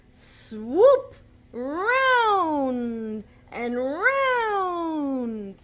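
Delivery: slow, loud, high swirling glide; swirling swoop. Acted. Leading kids to swirl like starlings, slow and swooping. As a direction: let the voice circle round and round.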